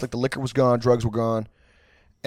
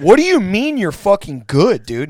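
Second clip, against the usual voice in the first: loud, higher, disbelieving yell; incredulous yell; 7 semitones higher, 6 dB louder than usual. Retold or imitated. Roommates yelling at stoned friend on couch, incredulous yell. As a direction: bark it at him in disbelief. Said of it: "We're like".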